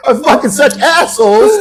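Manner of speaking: normal talking voice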